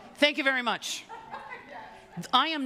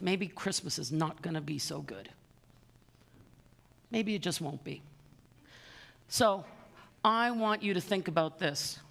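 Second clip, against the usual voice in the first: sincere appeal; 5 semitones lower, 5 dB quieter than usual. Real, not acted. Heartfelt charity appeal to bidders.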